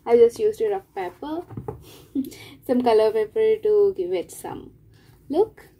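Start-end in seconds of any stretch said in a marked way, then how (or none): none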